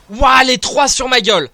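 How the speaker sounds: loud high cry of alarm; startled loud ouah les trois sur ma gueule; alarmed shout. Real, not acted. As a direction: yell as three enemies jump you. Three enemies attack.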